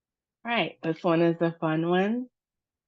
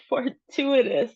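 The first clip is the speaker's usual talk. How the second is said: higher, laughing through fortuitous; laughing at own slip; 6 semitones higher than usual. Real, not acted. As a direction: stumble into the word with a real laugh. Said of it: "I can't speak today".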